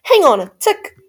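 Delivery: high, loud fairy voice; fairy's reply. A narrator's reading. Fairy answers Cindy's wish, high and loud.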